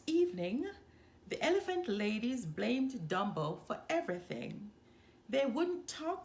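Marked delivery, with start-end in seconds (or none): none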